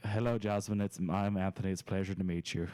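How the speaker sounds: low-pitched fake Irish lilt; Irish accent. Shown as a demonstration. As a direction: greet a date in a fake Irish brogue. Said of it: "Do the Irish accent".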